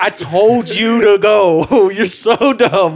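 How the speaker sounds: retold exasperated scolding. Friend telling off the buddy who hopped out, mock exasperation. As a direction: stretch out the exasperation.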